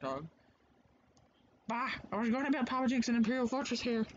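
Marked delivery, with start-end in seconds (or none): 1.7-4.0 lightly put-on grumpy character, a bit higher; game character voice. Voicing the game character for viewers, mild put-on grump. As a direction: read the game character's line in a hammed-up voice. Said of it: "I don't remember what voice I did with this guy".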